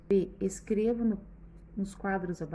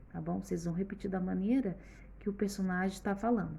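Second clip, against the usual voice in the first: usual speaking voice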